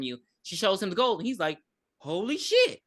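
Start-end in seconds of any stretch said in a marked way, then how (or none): 2.0-2.8 high, stunned "holy shit"; shocked exclamation. Retold or imitated. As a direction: gasp it out in shock. Retold reaction to gold.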